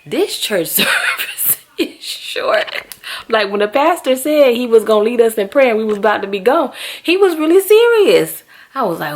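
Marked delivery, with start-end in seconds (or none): 0.1-2.9 laughing through the words, slow; amused surprise at how short it was; laughing disbelief. Retold or imitated. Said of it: "And I'm like". 3.3-8.4 louder and rising high on serious; emphatic exclaim. Real, not acted.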